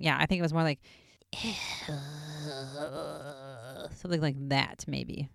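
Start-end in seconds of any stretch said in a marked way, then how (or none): 1.3-3.9 soft, long eerie breath out; creepy breathing. Shown as a demonstration. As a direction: breathe like a lurking ghost. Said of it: "creepy breathing".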